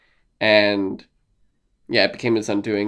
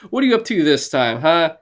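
higher, fast-fired questioning; rapid, pitched-up scolding; mock exasperation; 4 semitones higher than usual. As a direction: demand answers in playful annoyance. Acted exasperated player confronting Kenji.

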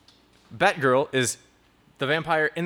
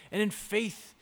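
higher, slow, a touch of preacher swell; mock preacher; 5 semitones higher, slower than usual. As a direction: ham up an earnest sermon. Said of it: "bad habit of doing in church".

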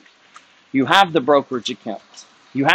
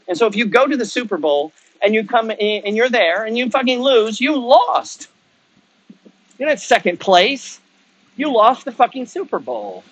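much higher, pressing harder and higher; heated rant; 8 semitones higher than usual. Real, not acted. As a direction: drive the point home, fired up. Heated rant about losing.

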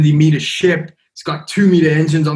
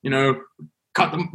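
usual speaking voice

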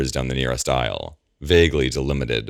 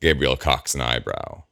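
ordinary delivery, nothing marked

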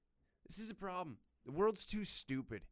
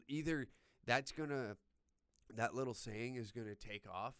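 ordinary delivery

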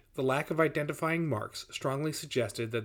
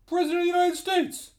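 very high-pitched called answer; shout across distance; 5 dB louder than usual. A narrator's reading. Guard answering the boss from afar, hollering. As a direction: holler it high to someone far off. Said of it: "exchange across some distance".

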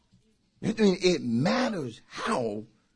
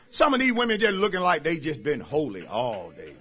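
ordinary delivery

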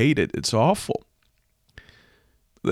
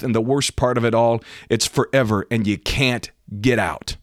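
raised, emphatic preacher's warning; fervent hellfire preaching; 5 semitones higher, 4 dB louder than usual. Real, not acted. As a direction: preach it urgently, pitch lifted, driving each point home. Preaches a warning about hell.

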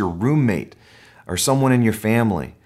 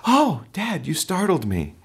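a much higher, mildly voiced little boy; a hint of a small son saying it flatly; mock calm kid; 8 semitones higher than usual. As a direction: mimic a polite, unbothered six-year-old. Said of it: "he doesn't just go".

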